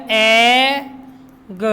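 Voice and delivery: एग drawn out, final ग apart; sounded out. Shown as a demonstration. Teacher asking what double g makes, एग slowly segmented. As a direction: stretch the vowel of एग, pause, say "guh". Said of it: "क्या हो गया?".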